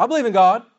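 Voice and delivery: defensive insistence. Retold or imitated. Churchgoer defending his faith when questioned, loud and offended. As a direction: bristle and insist you believe.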